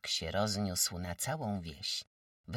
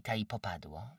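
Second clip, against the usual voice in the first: plain reading voice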